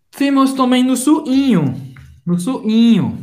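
loud, high, eager intro; excited announcement. Real, not acted. Teacher excitedly introduces the next expression to students. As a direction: burst in with the new topic.

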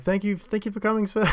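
high-pitched, eager usher thanks; imitating ticket guy. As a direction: mimic a keen usher thanking customers. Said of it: "it was like".

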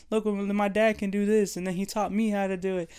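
put-on a boy bragging about his dad; bragging kid. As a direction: show off like a proud little boy. Kid stopping people on sidewalk.

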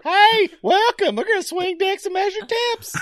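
high cartoon caricature, projected at the start; Dwight impression. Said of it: "All right, Dwight".

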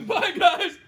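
high, loud laughing farewell; pained laughing goodbye. Real, not acted. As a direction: laugh through the pain, then bye. Burning from hot sauce.

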